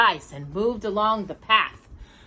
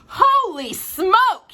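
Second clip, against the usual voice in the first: very slow, high, astonished; amazed; 12 semitones higher, 6 dB louder, much slower than usual. Acted. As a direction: gasp it out in disbelief. Amazed at Danny's shape.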